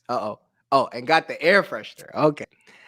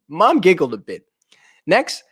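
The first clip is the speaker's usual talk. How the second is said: ordinary delivery, nothing marked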